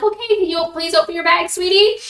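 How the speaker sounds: put-on high-pitched, quick sugary request to a child; sweet coaxing. Woman to shy trick-or-treater, retold sweetly coaxing.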